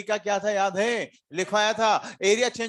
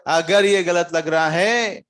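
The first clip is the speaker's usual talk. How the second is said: emphatic point; 8 dB louder, much slower than usual. Real, not acted. Teacher stresses point to class.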